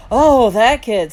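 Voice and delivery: loud, drawn-out "oh"; wide-eyed recognition. A narrator's reading. Little brother suddenly remembers the kid, wide-eyed. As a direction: let the memory hit, loud and slow. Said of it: "his eyes opening wide".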